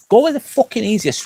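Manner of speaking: emphatic urging. Real, not acted. Urging viewer, pitch jump.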